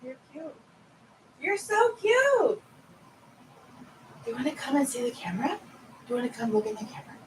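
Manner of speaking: high soft cooing, near a whisper; pet talk. Acted. To Daisy the cat, high hushed cooing.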